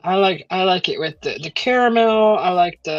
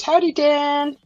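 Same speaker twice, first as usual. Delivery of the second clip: high chirpy howdy; 8 semitones higher than usual. Retold or imitated. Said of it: "Probably not that tone".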